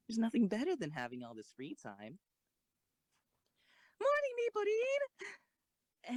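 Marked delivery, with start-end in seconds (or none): 4.0-6.2 high, loud, drawn-out slow greeting; bright call-out. Acted. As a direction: sing out her name across the yard. Calling out a cheerful greeting.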